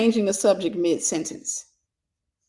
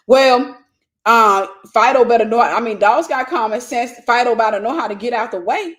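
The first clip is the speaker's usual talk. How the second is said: loud, higher, mocking someone's excuse; mock indignant retort; 3 semitones higher, 7 dB louder than usual. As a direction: shout it back like a careless owner. Mocking someone blaming the dog.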